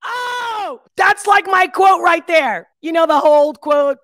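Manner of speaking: high, loud and slow, thrilled; excited loud exclamation. Real, not acted. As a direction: stretch it out with real excitement. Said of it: "I hope I wasn't too loud".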